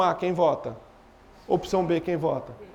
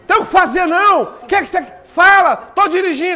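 loud, high exasperated shouting; irritated shouting; 8 semitones higher, 10 dB louder than usual. Retold or imitated. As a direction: snap at them down the phone. Annoyed partner on phone.

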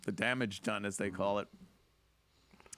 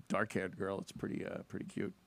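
ordinary delivery

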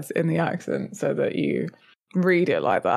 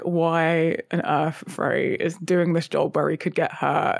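ordinary delivery

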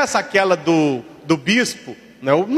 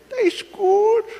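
put-on high, whimpering child; almost crying at the dark; scared little boy; 12 semitones higher, slower than usual. Little boy at the dark bathroom door, high frightened whimper.

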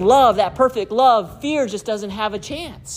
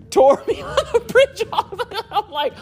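laughing hard through the words; wheezing with laughter mid-story; 10 semitones higher than usual. Real, not acted. Husband laughing telling how his wife sleepwalked onto the bridge.

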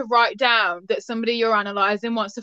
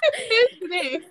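very high, hammed-up Victorian squeal; mimicked old-time speech; 11 semitones higher than usual. Friend echoing the Freud impression, high mock-Victorian voice. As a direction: echo the old-time phrase in a high silly voice. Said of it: "how people spoke in the eighteen hundreds".

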